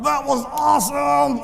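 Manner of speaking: high, loud, slow cry; thrilled shout. Retold or imitated. Listener leaving the speech, shouting high and loud. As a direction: burst out with excitement. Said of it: "you're gonna come out like".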